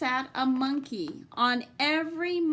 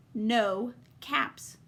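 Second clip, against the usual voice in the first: ordinary delivery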